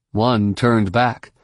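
ordinary delivery

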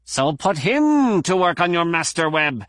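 a touch of a growl at the microbiologist. A narrator's reading. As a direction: Plee growling at a colleague. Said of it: "Doctor Plee growled".

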